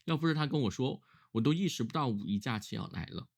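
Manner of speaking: ordinary talk, nothing marked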